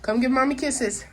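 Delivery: high, bright ask for a kiss; coaxing mom voice. Real, not acted. Mom asking toddler for kisses.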